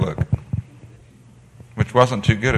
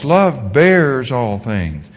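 slow emphatic verse; much slower than usual. Shown as a demonstration. Preacher stresses the verse.

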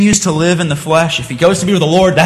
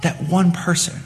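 soft reply, slowing into long pauses; hushed revelation; 3 semitones higher, 9 dB quieter than usual. Acted. Upset pianist explains about the front row, hushed and weighty. Girl reveals who stayed seated.

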